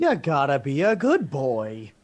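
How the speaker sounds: put-on slow, patronising good-boy voice; mock parental scolding. Cohost teasing the streamer in a slow patronising voice. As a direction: talk down to him like a child.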